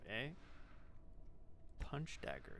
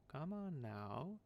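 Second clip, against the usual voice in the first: sing-song mumbling; 4 semitones higher, much slower than usual. Acted. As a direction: draw it out, swooping up and down. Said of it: "mumbling to herself in a sing-song voice".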